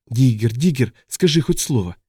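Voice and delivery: acted worried pleading; pleading mother. A narrator's reading. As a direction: beg an injured child to respond. Mother pleads with hurt son.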